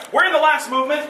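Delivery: laughing loudly at her own joke. Real, not acted. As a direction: say it through a burst of laughter.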